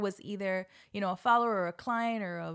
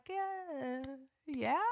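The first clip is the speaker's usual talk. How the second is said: soft, unimpressed mumble; a room that couldn't care less; half-hearted crowd; 4 semitones higher, 7 dB quieter than usual. Retold or imitated. Crowd shrugging at her name, soft and flat-toned.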